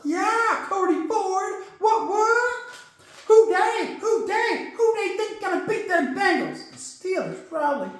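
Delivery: high squeaky put-on sidekick voice; a cocky little sidekick taunting; character voice. Puppet character voice mocking doubters.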